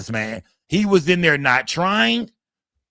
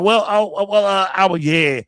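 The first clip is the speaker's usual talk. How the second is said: mock flustered stammering; lost for words; 4 semitones higher than usual. Lawyer answering the detective's challenge, imitated flustered stammer. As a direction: stumble over fillers, imitating someone caught out. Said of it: "didn't know what to say".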